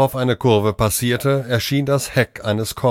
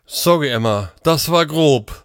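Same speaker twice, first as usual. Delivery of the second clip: panting; 6 semitones higher than usual. A narrator's reading. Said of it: "keuchte er".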